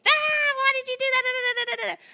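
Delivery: high, loud frantic scolding; hammed-up mom losing it; mock angry mom. Mom ranting at her child, hammed-up high and loud. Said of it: "I'm going off".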